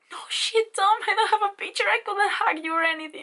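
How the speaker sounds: high, loud, frantic plea; frantic fan. Retold or imitated. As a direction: beg him in a high panicked squeal. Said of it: "And I was like".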